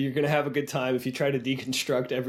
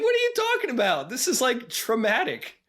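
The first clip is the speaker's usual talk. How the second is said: incredulous; 9 semitones higher than usual. Retold or imitated. Incredulous reaction to the kids.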